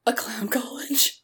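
gasping, slow whisper of wonder; awed whisper. Real, not acted. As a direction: breathe it out in amazement. Gasps at the clown college idea.

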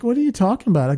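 high-pitched indignant protest; mock disbelief. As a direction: retell being affronted, pitch jumping up. Retold shocked reply to insult.

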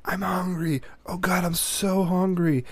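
high, slow mock whine; whiny hungry kids. Dad mocking his kids' endless whining, high and slow. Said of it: "put up with an hour plus of".

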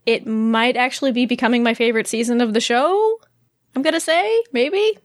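tentative, with questioning rises at the ends; uncertain. Real, not acted. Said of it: "You sounded really uncertain about that".

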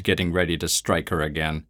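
ordinary delivery, nothing marked

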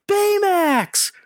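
high-pitched imitation of a child yelling; kid's excited yell. Kid yelling from the back of the theater, imitated high yell. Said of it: "a kid in the back of the theater yells".

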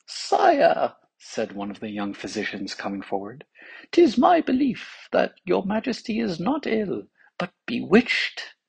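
put-on high, eager young physician voice. A narrator's reading. Young physician tells the king he is bewitched, high voice.

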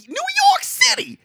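very high, squealed place name; comic exclamation. Pretend. Co-host repeating New York City for effect, comic high cry.

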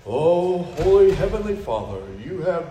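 loud, lofty hammed-up prayer voice; mock formal prayer. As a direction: ham up a pompous church prayer. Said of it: "Instead of praying like".

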